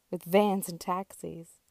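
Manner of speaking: usual speaking voice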